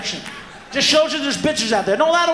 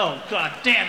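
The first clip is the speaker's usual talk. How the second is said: ordinary talk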